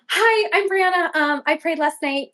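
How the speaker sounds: high, over-friendly retold greeting; sheepishly bright re-enacted hello; awkward introduction.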